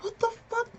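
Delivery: very high-pitched, squeaky disbelief; retold outraged squeal. Fan watching security swarm her idol, outraged squeal. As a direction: squeal it in mock panic.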